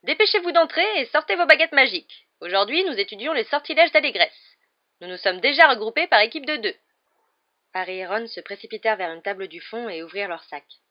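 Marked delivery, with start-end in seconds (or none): 0.0-6.8 high-pitched, loud teacher addressing the class; animated high-voiced professor; reproving professor, brisk. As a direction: hurry the pupils along in a high voice.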